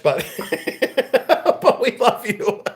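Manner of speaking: high voice breaking into laughter; cracking up. Real, not acted. Softening a teasing insult to a chat friend, cracking up. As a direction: laugh helplessly through the words.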